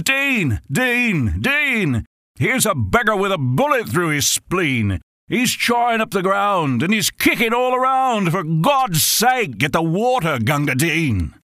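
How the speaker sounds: a wounded man's comrades screaming for Gunga Din; desperate cries for help. Acted. Wounded soldier calling for help.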